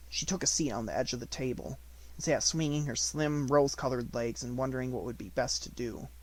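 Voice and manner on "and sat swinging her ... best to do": usual speaking voice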